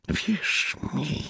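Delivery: rasped into his ear; hoarse whisper. A narrator's reading. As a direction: rasp it right into his ear. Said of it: "zachrypiał mu do ucha poeta".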